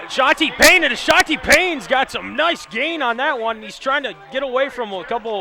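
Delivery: loud high excited call; excited play call. Real, not acted. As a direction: shout the big run. Big gain call.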